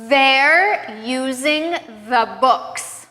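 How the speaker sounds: very slow, buzzing TH in They're and the; prolonged voiced TH. Shown as a demonstration. Teacher modeling practice sentences, very slowly exaggerating the voiced TH in They're and the. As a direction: draw out the voiced TH of They're, word by word. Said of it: "voiced T H sounds at the beginning".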